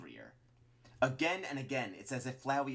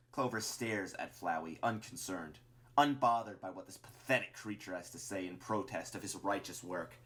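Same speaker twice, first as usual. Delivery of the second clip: ordinary delivery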